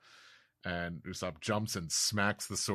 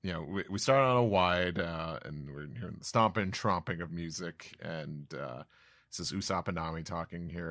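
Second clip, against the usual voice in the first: normal talking voice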